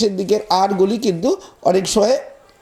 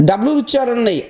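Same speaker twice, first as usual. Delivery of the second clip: usual speaking voice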